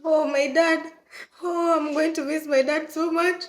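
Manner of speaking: half-sob it, missing dad; tearful longing. Retold or imitated. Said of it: "and I'm like".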